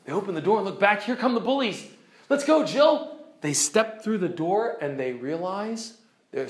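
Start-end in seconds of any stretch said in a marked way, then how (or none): 2.3-3.2 put-on boy calling out. Eustace urges Jill in story.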